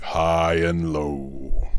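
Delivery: ordinary delivery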